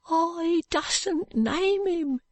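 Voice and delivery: put-on high, slow, frightened boy's voice; a scared boy who won't give the name; fearful street boy. Joe refuses to name him.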